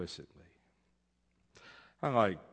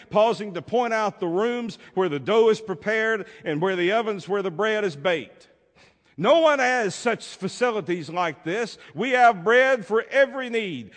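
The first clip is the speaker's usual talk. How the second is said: much louder, higher, showman's boast; boastful baker; 10 semitones higher, 9 dB louder than usual. Acted. Proud baker guiding the beggar through his halls, loud and high. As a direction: show off like a proud host.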